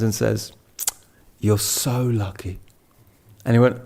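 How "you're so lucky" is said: slow, soft and tender; gentle, slow reassurance. Retold or imitated. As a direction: hold his hand and say it softly, slowly. Geshe grabs his hand.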